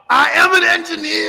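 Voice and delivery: loud, high shouted affirmation. Shown as a demonstration. Host demonstrating the affirmation for the boy, loudly shouted. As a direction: shout it out with full force. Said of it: "Say it louder".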